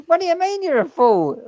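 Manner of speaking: high, loud incredulous cop; mock indignation. Cop hears an absurd answer, loud mock indignation. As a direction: challenge the answer loudly, as the officer.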